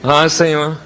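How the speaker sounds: usual speaking voice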